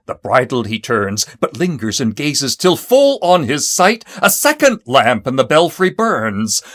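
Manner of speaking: ordinary delivery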